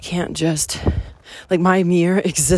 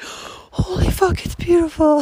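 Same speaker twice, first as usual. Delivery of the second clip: high, loud amazed gasp; awestruck exclamation; 12 semitones higher, 4 dB louder than usual. Retold or imitated. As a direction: gasp it out in wonder. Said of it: "and then you're like".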